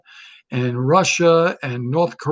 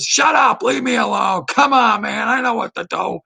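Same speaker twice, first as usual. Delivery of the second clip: mock angry Biden; 6 semitones higher than usual. Told to say something, the old candidate snaps back at his coaches. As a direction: bark it like a cranky old man waving off advice. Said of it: "and he goes".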